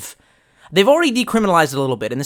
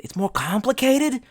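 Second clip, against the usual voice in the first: mock disbelief; 8 semitones higher than usual. Real, not acted. Mocking the chat's advice.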